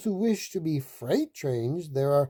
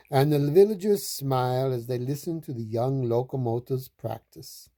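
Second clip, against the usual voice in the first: usual speaking voice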